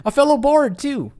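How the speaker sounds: high, loud delight; excited discovery. Acted. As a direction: burst out thrilled to meet a bard. Excited NPC line.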